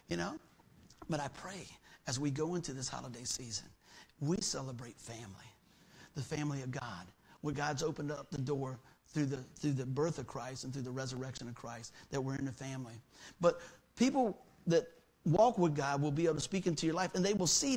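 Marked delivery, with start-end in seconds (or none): 1.1-13.0 prayerful. Real, not acted. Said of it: "prayerful lowered voice".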